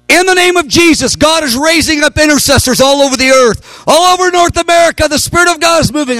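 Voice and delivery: ringing prophecy at full force; prophetic shout. Retold or imitated. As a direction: proclaim it to the whole arena. Prophesying to twenty thousand.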